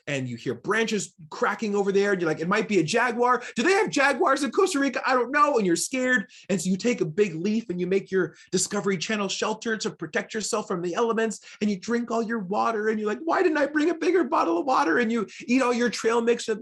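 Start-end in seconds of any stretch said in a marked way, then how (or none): 2.4-5.6 mock scared. Lost hiker hears branches cracking. 13.2-15.0 mock despair. Lost hiker out of water.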